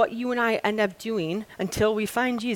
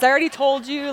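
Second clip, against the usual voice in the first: higher, louder, exasperated comeback; annoyed retort; 6 semitones higher, 6 dB louder than usual. Retold or imitated. Healed man fed up with the Pharisees' questions, exasperated and louder. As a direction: push back, fed up with repeating.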